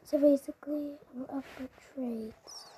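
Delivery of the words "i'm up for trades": hushed late-night whisper. Real, not acted. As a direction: keep it to a soft whisper. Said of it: "I must whisper now".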